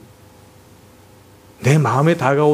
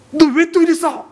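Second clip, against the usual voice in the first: scolding mom; 11 semitones higher than usual. Retold or imitated. Wife scolding child, retold.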